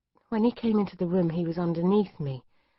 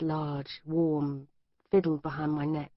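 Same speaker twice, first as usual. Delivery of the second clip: plain reading voice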